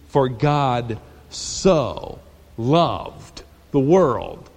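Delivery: very slow, low and hushed on so loved; reverent slow read. Shown as a demonstration. Preacher rereading the verse, slow and reverent. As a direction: let the words hang in awe. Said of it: "That's about as good as you can say it".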